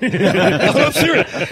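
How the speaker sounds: high, loud laugh into the words. Real, not acted. Cracking up insisting to the host he's serious.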